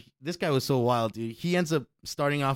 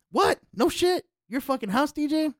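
surprised; 13 semitones higher, 4 dB louder than usual. Retold or imitated. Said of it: "Tim voiced, surprised".

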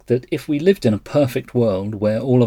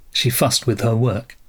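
ordinary delivery, nothing marked

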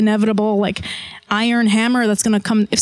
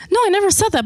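taken-aback denial; 10 semitones higher, much faster than usual. Retold or imitated. Retold protest in fight with mom.

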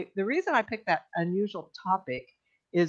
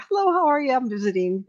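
high sing-out hello, dropping back; imitated cheery greeting; 6 semitones higher than usual. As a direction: play a chirpy visitor at the door. Visitor greeting her at the door.

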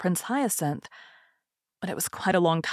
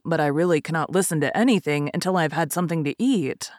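ordinary delivery

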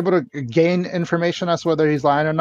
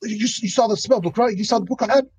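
much higher, frantic begging; desperate pleading; 9 semitones higher than usual. Acted. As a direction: beg them to believe you. Suspect pleads he's not lying.

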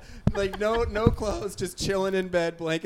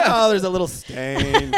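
drawn-out, louder mock-innocent excuse; mocking minimizer; 2 semitones higher, 10 dB louder, slower than usual. Roommate mimicking an excuse for cat mess, mocking.

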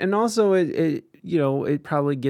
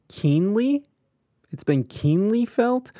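incredulous; 8 semitones higher, slower than usual. Real, not acted. Mocking a press quote.

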